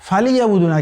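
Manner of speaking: ordinary delivery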